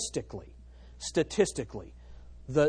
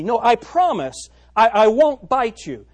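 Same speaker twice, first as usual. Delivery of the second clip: slow, loud, insistent begging snake; pleading snake; 5 semitones higher, 8 dB louder, slower than usual. Acted. Snake begs the girl to carry it, slow loud pleading. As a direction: lean in and swear you won't bite.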